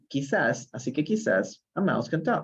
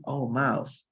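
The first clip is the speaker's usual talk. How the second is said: ordinary delivery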